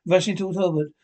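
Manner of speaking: ordinary delivery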